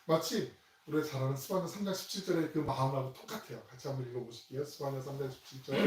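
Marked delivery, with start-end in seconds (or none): none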